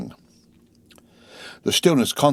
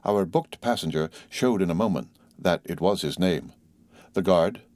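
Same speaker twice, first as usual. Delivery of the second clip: ordinary delivery, nothing marked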